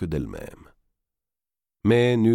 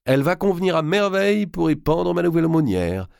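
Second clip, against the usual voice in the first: much higher and louder, for the next room; 12 semitones higher, 5 dB louder than usual. A narrator's reading. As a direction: speak up so the widow hears every word. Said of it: "reprit Marguerite bien fort".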